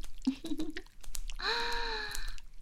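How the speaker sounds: high-pitched drawn-out moan. Acted. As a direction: let out a long high cry. (高声呻吟)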